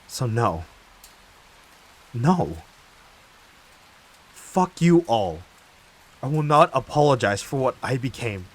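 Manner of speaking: loud, high, emphatic refusal; furious defiance. Acted. Wronged speaker refuses to apologize to accusers, furiously defiant. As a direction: build from firm refusal to full-voiced fury.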